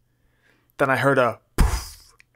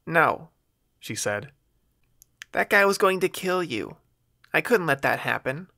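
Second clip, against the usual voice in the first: put-on defiant young woman; 6 semitones higher than usual. A narrator's reading. Mandy voiced higher.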